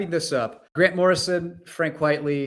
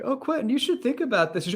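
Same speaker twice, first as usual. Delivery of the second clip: high-pitched mock dismay; hammed-up fretting; Professor X; 11 semitones higher than usual. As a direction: feign shock with a wailing laugh in it. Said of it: "I love Professor X's art of like".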